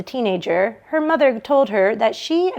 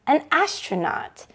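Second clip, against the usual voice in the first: ordinary delivery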